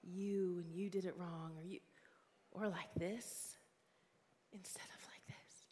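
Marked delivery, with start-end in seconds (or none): 4.5-5.6 hushed whispered contrast; whispered demo of the better way. Shown as a demonstration. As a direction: drop to a breathy whisper for the right way. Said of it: "so often the gospel's like this".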